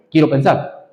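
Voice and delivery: ordinary talk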